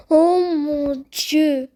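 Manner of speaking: long drawn-out, stunned exclamation; awed amazement. Real, not acted. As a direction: stretch it out slowly in amazement. Awed reaction to the game.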